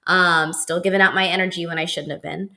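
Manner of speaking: normal talking voice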